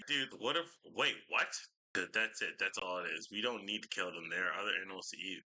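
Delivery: ordinary talk, nothing marked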